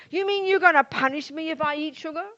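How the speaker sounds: loud, put-on voice, incredulous; mock-outraged question; indignant disbelief. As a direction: sound offended and disbelieving. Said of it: "And you do not say".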